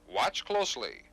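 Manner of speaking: normal talking voice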